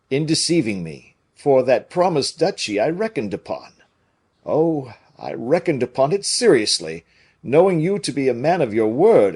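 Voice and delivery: earnest musketeer; Porthos voice. A narrator's reading.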